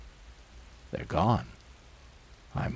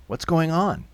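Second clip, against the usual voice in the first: ordinary delivery, nothing marked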